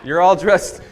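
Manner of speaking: talking through laughter to the crowd; laughing. Real, not acted. Pastor jokes with church, laughing. Joking aside to congregation.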